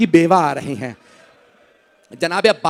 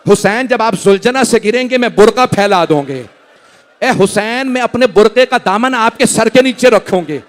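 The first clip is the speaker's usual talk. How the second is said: rising lament; 6 semitones higher, 7 dB louder than usual. Acted. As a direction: build the grief line by line toward a cry. Lament recitation builds to a cry.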